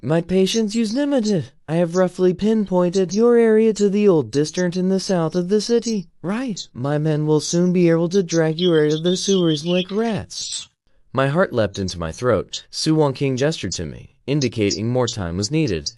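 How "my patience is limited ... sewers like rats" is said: higher, clipped-free menacing boss voice; controlled villain. A narrator's reading. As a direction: threaten coolly from a position of power. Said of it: "voice controlled".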